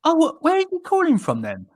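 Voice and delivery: put-on high, puzzled old-guy voice; an older man on the phone, confused; imitating the old caller. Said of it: "And he goes".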